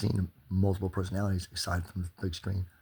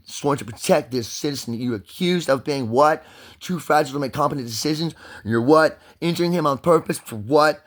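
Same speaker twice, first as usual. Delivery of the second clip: furious rant; 4 semitones higher, 6 dB louder than usual. Real, not acted.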